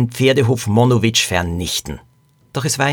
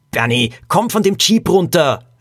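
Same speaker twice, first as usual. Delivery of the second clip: raised, higher-pitched alarmed call; horrified call; 6 semitones higher than usual. A narrator's reading. Lilo orders her friend's cousin off the Jeep, horrified call. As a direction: call out in mild horror. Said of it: "rief Lilo entsetzt".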